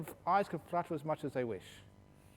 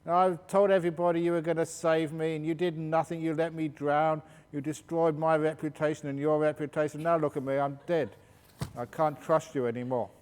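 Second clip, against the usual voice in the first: louder, indignant reproach; upset complaint; 3 semitones higher, 7 dB louder than usual. Retold or imitated. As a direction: scold the goddess for letting him drown. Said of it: "He was really upset about it".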